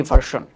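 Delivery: "ভার্সন" with a hard sh, VAR-shon; everyday VAR-shon for "ভার্সন"; common pronunciation. Shown as a demonstration. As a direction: say "ভার্সন" the Bengali way, r sounded, sh. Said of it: "আমরা সচরাচর এই শব্দটাকে বলে থাকি".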